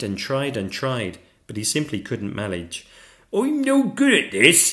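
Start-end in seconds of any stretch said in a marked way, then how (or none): 3.3-4.7 put-on high, loud despairing young dragon; despairing cry. A narrator's reading. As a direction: wail as a discouraged little dragon. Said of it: "he cried".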